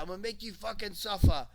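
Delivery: high, loud mock British accent. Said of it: "that's my best British accent".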